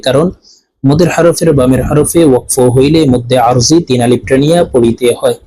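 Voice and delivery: ordinary delivery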